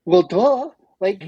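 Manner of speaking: loud, high mocking "duh"; mock scornful retort. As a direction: toss it back as if it's obvious. Retells the man's scornful answer.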